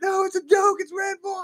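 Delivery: very high, yelled protest; retold panicked shout; frantic explaining. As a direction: plead loudly over an angry crowd. Pleading with the angry crowd.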